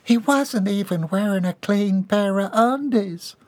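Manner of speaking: high, slow moan; moaning Bobby Sue. A narrator's reading. Bobby Sue grieving at the table, high slow moan. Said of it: "Bobby Sue moaned".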